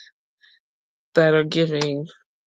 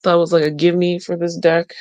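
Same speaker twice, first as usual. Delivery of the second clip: usual speaking voice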